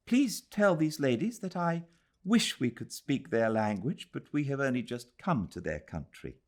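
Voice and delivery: put-on a young English visitor, polite and keen; Adela's voice.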